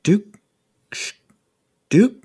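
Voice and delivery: high calling voice of a distant woman, rising; faint woman's voice. Acted. A woman's voice over the comm calling Duke. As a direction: call his name from far away, questioning. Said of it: "It's a woman's voice over the comm calling out Duke's name".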